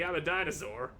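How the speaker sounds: ordinary delivery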